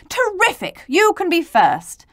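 high, bright-hearted exclamation; delighted. Acted. Adult granting T first place, delighted.

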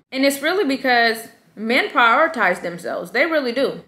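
ordinary talk, nothing marked

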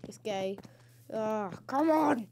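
normal talking voice

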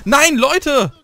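loud, high frustrated cry; frustrated shout. Real, not acted. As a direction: yell it in despair. Dies, yells at teammates.

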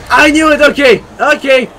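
loud, high cries of alarm; high shouted panic; startled yelling. Real, not acted. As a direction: yell it as the scare hits. Scared again by the jump scare.

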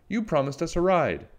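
put-on high-pitched child's protest; animated storybook plea; protesting cry. A narrator's reading. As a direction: remind them urgently, pitched well up. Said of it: "cried Cherry".

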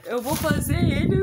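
loud, tearful giggling words; laugh-crying. Real, not acted. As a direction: crack up while saying it.

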